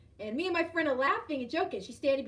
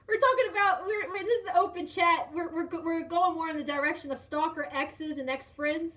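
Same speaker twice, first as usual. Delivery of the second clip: usual speaking voice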